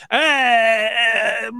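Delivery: long, drawn-out noncommittal "eh"; put-on whine, stretching out the dodge; mock evasive groan. Mocking comics asked if a friend's special is good, evasive groan. Mocks comics dodging an honest opinion.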